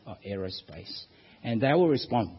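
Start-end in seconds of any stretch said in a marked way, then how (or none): 0.1-1.1 very soft, low, offhand reply; hushed mock-modest answer; quiet humblebrag. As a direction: drop it quietly, as if it were nothing. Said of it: "you know, sort of quietly".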